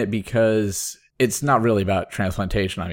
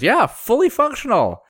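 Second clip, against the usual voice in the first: amazed doctor; 4 dB louder than usual. Retold or imitated. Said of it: "the other one says".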